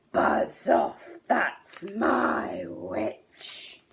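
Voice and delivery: slow, rasping whispered beast; monster growl. A narrator's reading. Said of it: "yowl and a growl and a croak and a shriek".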